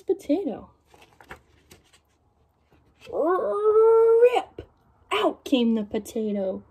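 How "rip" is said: high, louder sound effect rip; ripping sound. A narrator's reading. Reading to kids, potato bursts out with a rip. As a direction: make the potato tear loose.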